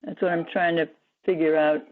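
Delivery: speaking through a phone line; over the phone. Real, not acted. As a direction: voice coming down a phone. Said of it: "I'm speaking through my phone".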